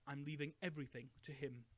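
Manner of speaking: ordinary delivery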